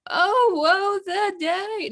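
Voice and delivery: high, very slow mock woe; melodramatic wail. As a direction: wail it like a tragic heroine. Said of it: "very dramatic".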